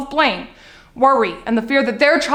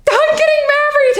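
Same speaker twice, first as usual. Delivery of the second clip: very high, gleeful squeal of news; acted daughter thrilled to share news; excited announcement; 5 dB louder than usual. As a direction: burst out with it, pitched way up. Daughter announces her wedding, acted.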